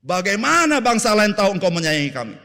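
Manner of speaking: loud, high pleading cry; hammed-up desperate plea; pleading Moses. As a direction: Moses begging God to come along. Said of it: "Musa bilang apa".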